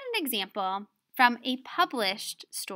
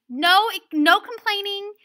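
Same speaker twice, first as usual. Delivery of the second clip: raised, emphatic mock-teacher refusal; harsh; 8 semitones higher, 6 dB louder than usual. Imitating Miss Bunyan shutting down complaints, harsh and emphatic. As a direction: cut off complaints, higher and louder. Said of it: "she's kind of harsh".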